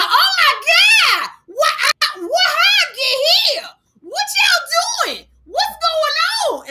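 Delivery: very high, slow, shouting confused woman; panicked stranger. Retold or imitated. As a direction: shriek out in bewildered panic, half laughing. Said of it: "a woman with a shower bonnet on her head going".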